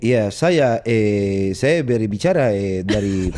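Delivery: Indonesian in a Spanish accent, low and halting with long ehs. Shown as a demonstration. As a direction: speak Indonesian like a Spaniard, slow and hesitant. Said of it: "bahasa Indonesia tapi pakai aksen Spanyol".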